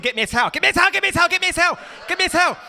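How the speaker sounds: high loud frantic towel demand; pleading child. Retold or imitated. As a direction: keep begging for the towel. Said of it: "the international sign for".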